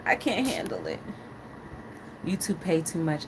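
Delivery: normal talking voice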